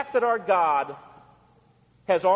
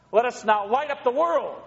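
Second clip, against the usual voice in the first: ordinary talk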